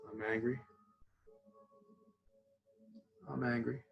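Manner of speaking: softly, inconspicuously murmured mantra; nonchalant. Shown as a demonstration. Teacher showing how to do it unnoticed in class, nonchalant. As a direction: say it offhand, under the radar. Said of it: "inconspicuous type thing or a nonchalant".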